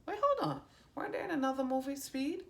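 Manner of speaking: excited high-pitched wait, fast; sudden surprise. Real, not acted. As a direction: catch yourself as it suddenly clicks. Sudden realization mid-reading.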